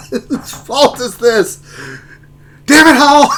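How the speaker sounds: high, loud laughing mock rage; hammed-up friend's furious rant; imitating Urz's outburst. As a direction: blow up at Hal, slow then shouting through laughter. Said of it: "His reaction is like".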